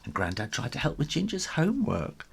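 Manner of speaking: plain reading voice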